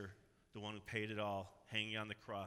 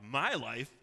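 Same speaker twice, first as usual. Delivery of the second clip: triumphant cry; 6 semitones higher, 7 dB louder, much slower than usual. Acted. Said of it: "so that I can cry out".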